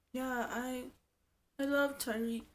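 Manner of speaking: soft, lifeless put-on voice; mock dead-eyed Hazel. Imitating Hazel's empty love declaration, softly. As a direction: say it with no spark at all. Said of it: "she's always like this".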